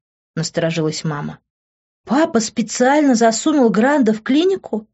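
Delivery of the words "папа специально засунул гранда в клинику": high-pitched, mounting outrage; incredulous questions climbing into a contained yell; outraged yell. A narrator's reading. Daughter learning what her father did, yelling. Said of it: "заорала я".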